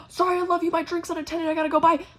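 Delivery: high, loud, breezy goodbye; hurried gushing. Retold or imitated. Bar lady rushing back to her drink, hurried gushing. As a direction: gush it high on the run.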